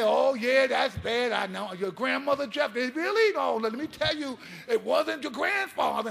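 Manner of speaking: loud, beaming coach brushing off bad news; over-the-top cheerful coach. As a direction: boom out the silver lining like an unstoppable coach. Coach's relentless optimism, acted out.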